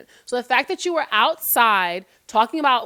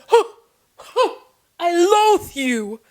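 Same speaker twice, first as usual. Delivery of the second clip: slow dramatic gasp then loathe; hammed-up soap villain; 5 semitones higher, 6 dB louder, slower than usual. Parodying soap opera acting, slow and hammed-up. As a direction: overact it like a soap opera diva. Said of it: "the scene switches".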